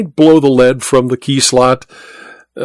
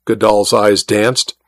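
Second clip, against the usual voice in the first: usual speaking voice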